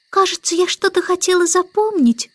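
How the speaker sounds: put-on high, childlike voice; puzzled baby girl. A narrator's reading. Baby struggles to remember, high puzzled little voice. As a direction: puzzle over it as a tiny girl. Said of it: "нахмурившись, сказала Аннабелла".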